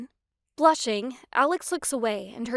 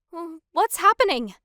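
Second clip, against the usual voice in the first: startled fear; 7 semitones higher, 8 dB louder than usual. A narrator's reading. Explosion shakes the ground.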